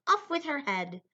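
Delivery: loud, high storybook queen's command; yelling queen. A narrator's reading. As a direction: cry it out like an imperious queen. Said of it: "always yelling".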